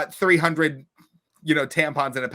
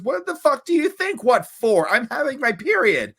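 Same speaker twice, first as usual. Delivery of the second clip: high, louder mock outrage; exasperated retort; 9 semitones higher, 4 dB louder than usual. Friend scolding the narrator about pads, mock outraged. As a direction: snap back in disbelief at a clueless friend. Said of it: "And she goes".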